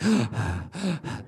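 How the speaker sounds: imitated gasping breaths of a sleeping baby; baby breathing demo. Shown as a demonstration. Showing the audience how babies sound asleep, imitated gasps. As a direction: uneven little gasps, mimicking a baby.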